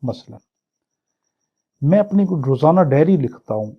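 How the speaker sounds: ordinary talk, nothing marked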